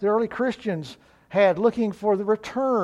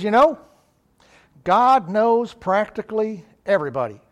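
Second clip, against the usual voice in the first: comedian's punchline with "practically" set apart before "everybody"; raised, louder opening on "You know", then "God knows practically everybody" spaced out; Jack Benny; 3 semitones higher, 5 dB louder than usual. Retold or imitated. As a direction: deliver "practically everybody" as a retold comic punchline, pausing between the words. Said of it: "in true Benny form".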